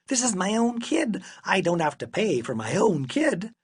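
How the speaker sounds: high-pitched, incredulous objection; squawking father's complaint; indignant protest. A narrator's reading. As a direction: object in outraged disbelief. Father protests having to pay.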